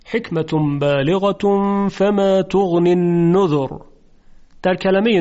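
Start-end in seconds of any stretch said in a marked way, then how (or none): 0.1-3.7 slow chanted verse; Quran recitation. Shown as a demonstration. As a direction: recite it slowly with tajwid.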